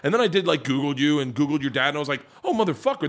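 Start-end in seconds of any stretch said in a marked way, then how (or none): 2.4-3.1 mock amazement. Surprised realization retold.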